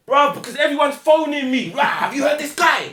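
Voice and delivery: high, loud, breathless hype; rowdy high-pitched rallying cry; hyped-up rant. Retold or imitated. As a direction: shout it excitedly down the phone. Acting out man's excited hype.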